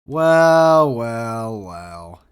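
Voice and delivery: hammed-up villain greeting; mock villain well well well. Greeting late cohost theatrically.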